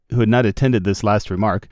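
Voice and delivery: plain reading voice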